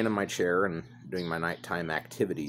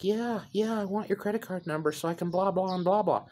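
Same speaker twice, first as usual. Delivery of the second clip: much higher, eager put-on scammer voice; mimicking the scammer. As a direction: parrot the greedy scammer.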